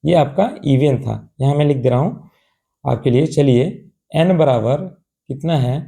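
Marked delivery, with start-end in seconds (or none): none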